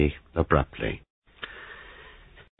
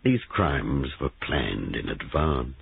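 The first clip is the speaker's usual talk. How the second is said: slow, weary and a touch lower; tired voice; 3 semitones lower, slower than usual. Acted. Sergeant sighing at Giles, slow and weary. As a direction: let the tiredness drag each word. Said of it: "His voice sounded tired".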